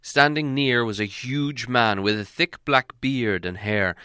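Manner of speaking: ordinary delivery, nothing marked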